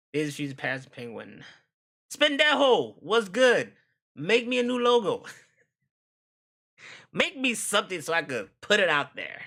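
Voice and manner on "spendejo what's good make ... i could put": loud, high hype greeting; excited shout-out. Real, not acted. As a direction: call out with hype. Calling out to chat member.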